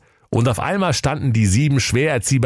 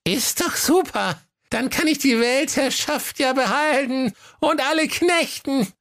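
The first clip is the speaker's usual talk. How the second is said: very high, gloating goat voice; evil goat. A narrator's reading. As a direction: relish it like a little villain. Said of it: "rief mit glühend roten Augen". (hohe, böse triumphierende Geißleinstimme)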